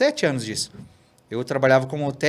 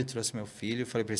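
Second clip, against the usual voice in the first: usual speaking voice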